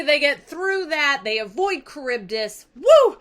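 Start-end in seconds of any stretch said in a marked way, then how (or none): 2.8-3.2 high loud whoop of triumph; excited cheer. Acted. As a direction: cheer like they just made it. Cheering escape from Charybdis.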